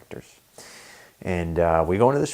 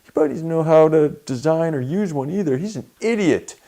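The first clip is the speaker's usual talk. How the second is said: loud, much higher mocking jeer at a coworker; in-your-face taunting; 10 semitones higher, 10 dB louder than usual. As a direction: get in his face and rub it in. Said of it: "barges in and goes".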